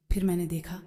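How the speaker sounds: ordinary delivery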